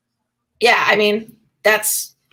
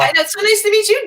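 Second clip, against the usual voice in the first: much higher and fast, gushing; excited greeting; 12 semitones higher, 3 dB louder, faster than usual. Real, not acted. Host greets interviewer Double D, gushing and high-pitched. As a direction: squeal it out with delight.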